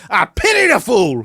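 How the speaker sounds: loud, drawn-out mock growl of a catchphrase; slow, high, booming TV tough-guy line; catchphrase impression. Imitating a TV catchphrase for a co-host, loud mock bellow. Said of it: "cuts back to me and he goes".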